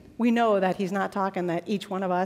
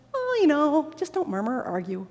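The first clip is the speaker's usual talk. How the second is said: soft, offhand, falling away gently; lenient, easygoing; 4 semitones higher, 3 dB quieter than usual. Acted. Preacher voicing Paul to his favorite Philippians, mild and indulgent. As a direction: let them off lightly. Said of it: "feels like he's letting them off".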